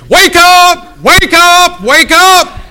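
high, full-voiced street shout; screaming wake-up call. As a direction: holler it to wake the whole block. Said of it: "start screaming at the top of your voice".

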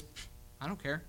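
quiet, dismissive brush-off; a sulky teen waving off a parent. Retold or imitated. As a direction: shrug off the warning with a scoff. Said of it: "Sometimes their response is".

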